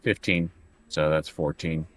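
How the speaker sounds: ordinary delivery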